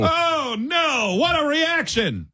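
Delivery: high, excited play-by-play; frantic mock sports commentary. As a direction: shriek like a commentator at a blunder.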